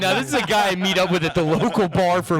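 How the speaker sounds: mock announcer voice. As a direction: present the buddy grandly. Mock introducing friend voice.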